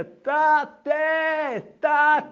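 high, loud, long-drawn wail of "תתה"; child crying out. Retold or imitated. Locked-in boy calls out for his father, high loud and drawn-out. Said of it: "שומע קול מתוק מעבר למחיצה".

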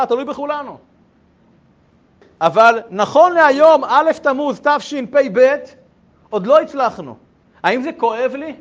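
loud, impassioned rhetorical questions; fervent preaching. Acted. Rabbi urges students the Messiah depends on them, loud and impassioned. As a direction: build to a passionate plea. Passionate plea about the Messiah.

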